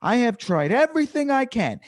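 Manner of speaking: high-pitched exasperated rant at a heckler; worked-up scolding, easing off at the end; loud, upset. Acted. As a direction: blow up at them after trying everything. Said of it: "like, loud a couple of times".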